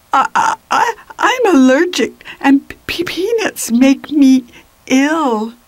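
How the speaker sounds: high, stuttering and nervous; stammering. A narrator's reading. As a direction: stumble over the words in fear. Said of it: "started stammering".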